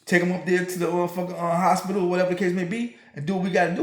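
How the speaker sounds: normal talking voice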